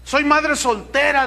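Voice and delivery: hammed-up anxious mother; desperate widow. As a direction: beg the pastor for an answer. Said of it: "dirá otra".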